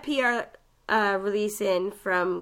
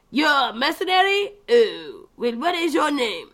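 fake French barkeep; 4 semitones higher, 3 dB louder than usual. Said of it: "and it's like".

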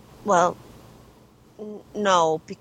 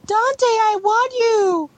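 high, drawn-out swooning mockery; mock lovesick Lucia; 12 semitones higher, much slower than usual. Gamer mocking Lucia pining for Dante, mock lovesick. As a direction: coo it slowly in a high put-on voice. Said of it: "you didn't want her to be all like".

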